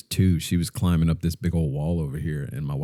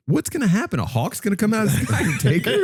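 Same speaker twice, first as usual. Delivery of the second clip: much higher, laughing disbelief; mock incredulous. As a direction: scoff at her worry, half laughing. Dad mocking wife's worry.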